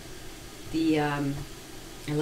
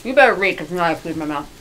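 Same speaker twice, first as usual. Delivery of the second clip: ordinary talk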